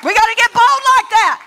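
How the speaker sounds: loud, rising sermon cry; full-force preaching. Acted. Pastor urging the church to cast out the devil, shouting. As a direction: preach it out with fire.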